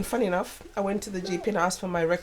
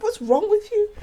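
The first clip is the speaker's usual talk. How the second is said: exasperated mum; 13 semitones higher, 7 dB louder than usual. Retold or imitated. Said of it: "I was like".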